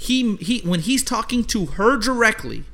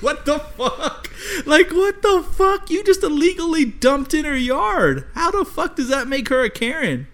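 laughing disbelief; 10 semitones higher than usual. Retold or imitated. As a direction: cackle through the disbelief. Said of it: "And I'm like".

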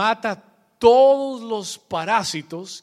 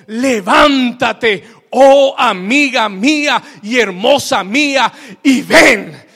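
loud, raised call, first word drawn out; proclaimed scripture; 4 semitones higher, 9 dB louder, slower than usual. Acted. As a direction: call out the verse like a summons. Preacher proclaims quoted scripture call. (proclamación solemne en voz alta)

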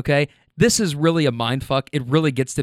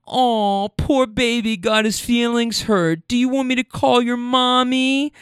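put-on high, drawn-out baby-talk taunt; mocking pity; 8 semitones higher than usual. Woman taunts driver at gas station, mock baby-talk pity. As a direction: coo in fake sympathy, slow and high.

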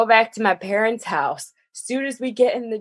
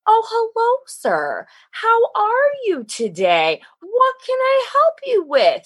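high-pitched, over-polite sales welcome; mock fawning greeting; 14 semitones higher than usual. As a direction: gush sweetly at a valued customer, mockingly. Dealer staff fawn over dad.

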